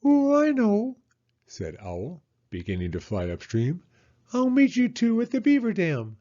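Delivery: high, loud, proud owl; Owl's voice. Acted. Owl answering Mole then flying off, high and proud. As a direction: boast cheerfully as Owl.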